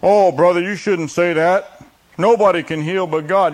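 preacher mocks a believer rebuking healing talk, in a loud scolding voice; mock scolding objector. Mocking a religious objector.

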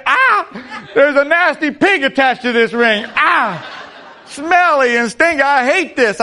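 high, loud, laughing cry of disgust at the smelly pig; disgusted ring owner. Acted. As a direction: squeal in comic revulsion. Preacher acting disgusted ring owner.

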